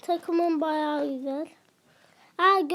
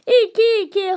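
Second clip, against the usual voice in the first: screaming football commentator; 12 semitones higher, 9 dB louder than usual. Real, not acted. Scores equaliser in game.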